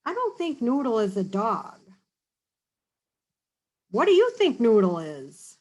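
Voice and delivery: plain reading voice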